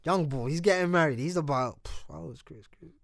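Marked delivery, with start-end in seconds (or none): none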